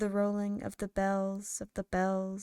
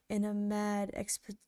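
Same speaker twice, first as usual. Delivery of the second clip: usual speaking voice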